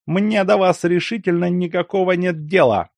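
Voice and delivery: high sharp snap; sharp retort. A narrator's reading. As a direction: cut him off sharply. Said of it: "резко отозвался Лиховский".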